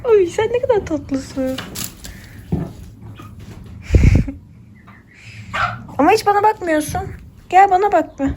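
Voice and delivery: high, coaxing voice calling an animal; pet talk. Real, not acted. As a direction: sweet-talk the calf into looking up. Coaxing a calf to look. (yüksek sesle tatlı tatlı seslenme)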